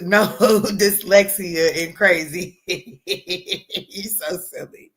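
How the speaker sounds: slow words through real laughter; laughing at a silly comment. Real, not acted. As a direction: giggle the words out.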